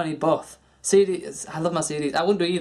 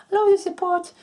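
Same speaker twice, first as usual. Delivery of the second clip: much higher, louder squeaky fan voice; imitating fans; 5 dB louder than usual. Fans praise the vlogger, in a high squeaky gushing voice. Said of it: "everybody's giving me".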